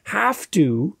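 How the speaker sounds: "Have to" pushed up on "Have"; stress on have to. Shown as a demonstration. Podcaster repeating gurus' rule, mock stress on "Have to". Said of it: "one more time".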